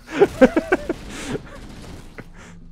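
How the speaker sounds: high, squealing laugh. Real, not acted. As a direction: break into a shrieking laugh. Laughing at the failure.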